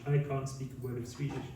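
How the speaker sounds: ordinary delivery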